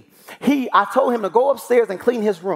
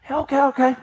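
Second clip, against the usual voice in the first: put-on young son giving in; 6 semitones higher than usual. Young son gives in to father.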